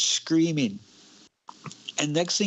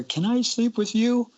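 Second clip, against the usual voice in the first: put-on pleading little kid; 9 semitones higher than usual. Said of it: "So I asked my brother".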